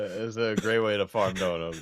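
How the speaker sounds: normal talking voice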